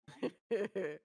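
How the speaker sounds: real laugh at a friend's remark; amused laugh. Real, not acted. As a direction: burst into a short laugh. Laughs at the ranking.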